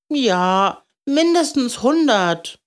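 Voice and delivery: put-on high child's voice; relieved little boy. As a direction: exclaim it like a kid glad to be understood. Grateful boy exaggerates.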